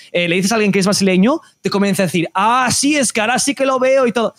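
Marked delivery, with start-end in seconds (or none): 2.3-4.3 higher-pitched imitation of someone catching on; mock sudden realisation. Listener told someone is Brazilian, mock sudden realisation. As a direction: play a listener who claims they knew it.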